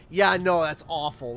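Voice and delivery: loud Canadian "Yeah, no" then dropping into "that's awful". Shown as a demonstration. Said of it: "we'd say".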